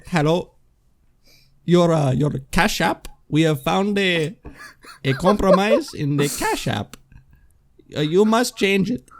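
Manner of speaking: mock Indian call-center accent. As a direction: imitate an Indian phone scammer's accent. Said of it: "an Indian person is calling me".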